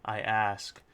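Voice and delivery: ordinary delivery, nothing marked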